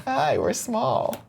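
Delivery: high, slow, soft little voice; tiny person. Pretend. Host joking about the tiny video box, high tiny voice. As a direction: squeak it like a miniature person. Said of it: "we're still tiny in this box".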